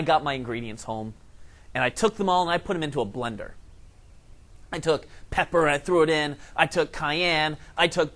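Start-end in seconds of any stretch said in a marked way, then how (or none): none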